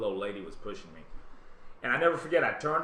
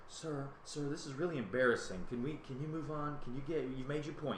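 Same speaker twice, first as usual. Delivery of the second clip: hushed plea; 6 dB quieter than usual. Retold or imitated. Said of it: "She says".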